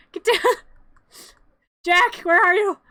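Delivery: high, loud, laughing summons; exasperated calling out. Real, not acted. Storyteller searching for Jack, calling loudly. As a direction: holler for someone who's gone missing. Calls to misbehaving, missing figures.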